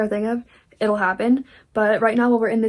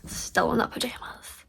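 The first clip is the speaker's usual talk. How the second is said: whispered; 8 dB quieter than usual. Real, not acted. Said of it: "Look at this".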